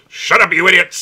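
put-on loud, high old man yelling; grumpy old man. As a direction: bark it like a cranky old vet. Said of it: "almost a decent impersonation of him".

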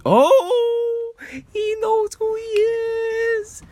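very high, drawn-out mock croon; teasing falsetto. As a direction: stretch it out in a silly falsetto. Mock teasing of unnamed guy.

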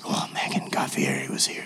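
hushed, awestruck whisper; whispering to his wife at a restaurant about a celebrity sighting. Retold or imitated. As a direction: breathe it like a secret.

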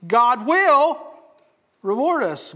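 "will" pushed high and loud, then a pause; stress on WILL. Shown as a demonstration. Preacher shows each word's emphasis, stressing will. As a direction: land firmly on the word will. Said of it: "do the emphasis on each word".

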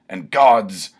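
loud bellow at the sky. Acted. Barbarian calls out to the gods, loud bellow. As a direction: bellow it up to the heavens. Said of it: "he bellowed".

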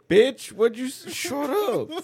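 high, loud indignant snap; mock outrage. Friend ranting at teasing kids, high mock indignation. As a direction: squeal it in fake offense.

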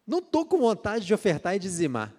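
high, loud mock complaint; reluctant church member. Preacher mocking a member who doesn't want to tithe, high loud whine. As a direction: whine about giving the offering. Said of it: "essa é a campeã".

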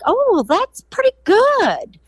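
high mock-impressed "Oh, well"; impressed manager. The manager hears Harry's sales count, mildly impressed. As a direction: lift the voice way up, pleasantly surprised.

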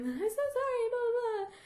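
high, whiny fake sob apology; mock tearful Harry begging; mock crying apology. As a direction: wail it like a hammed-up sorry boyfriend. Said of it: "Harry is crying now".

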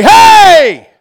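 high, very loud "hey"; acted attention-getting shout. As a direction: shout to someone who is ignoring you. God getting an ignored listener's attention.

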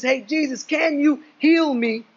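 lightly acted pleading leper; imagined leper's plea. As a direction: beg Jesus for healing, lightly voiced. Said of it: "He doesn't ask Jesus".

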